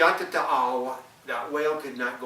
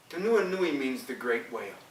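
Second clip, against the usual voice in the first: ordinary delivery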